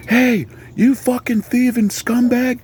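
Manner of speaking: very high, slow mock yell; servant's yell. Servant catching a carriage thief, high mock-outraged yell. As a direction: holler it in a high, drawn-out acted screech.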